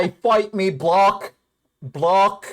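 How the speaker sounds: attempted Australian accent. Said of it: "you can't say block out of the top of your mouth".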